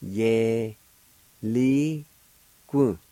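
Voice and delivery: Ye, li, gu said one syllable at a time, each rising; syllables spelled out. Shown as a demonstration. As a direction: call out Ye, li, gu syllable by syllable. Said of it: "it's pronounced like this".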